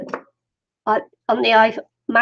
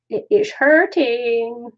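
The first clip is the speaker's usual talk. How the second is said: drawn-out playful moan; mock moan; 2 semitones higher, much slower than usual. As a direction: moan it slowly, half in jest. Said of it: "I said I was gonna have a moan".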